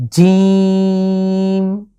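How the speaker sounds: জিম held long and loud; long Arabic jim. Shown as a demonstration. Teacher shows Quran letter jim to viewers, drawn out. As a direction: stretch জিম over three counts, loud and steady. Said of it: "আমরা লাগিয়ে উচ্চারণ করব".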